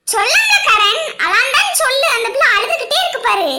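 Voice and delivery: wife shouting at her husband to comfort the bride; scolding shout. Acted. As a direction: snap at him to say it properly.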